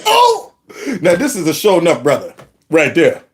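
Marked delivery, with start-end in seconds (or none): 0.1-0.5 high, sudden "Oh!"; delighted oh. Real, not acted. Host hears "bunch of bull" and yelps a high delighted oh. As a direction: burst out in delighted surprise.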